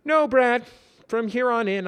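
high, loud emphatic voice; earnest. Acted. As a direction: declare it firmly. Simon, earnest.

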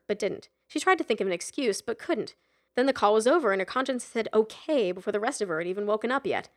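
usual speaking voice

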